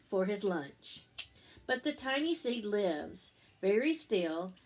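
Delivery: gentle picture-book read-aloud; reading to children. A narrator's reading. Parent reading Eric Carle to Lucas, gentle storytelling.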